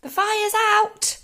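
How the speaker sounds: high, slow, loud proclamation; proud announcement. A narrator's reading. George to Grandma after dousing her, proud announcement. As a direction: announce it proudly, drawing the words out. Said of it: "George announced proudly".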